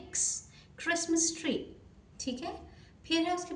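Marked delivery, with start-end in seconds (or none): 0.1-0.5 क्स as a quick k into a hiss; the X sound, क्स; ks blend. Shown as a demonstration. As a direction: snap क into स, क्स. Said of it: "आधा क और स बन के मिल के".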